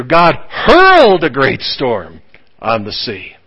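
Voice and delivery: slow, with "hurled" pressed; weighty reading. Real, not acted. Preacher savours the vivid scripture slowly. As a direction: linger on "hurled". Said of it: "the scripture is very picturesque here".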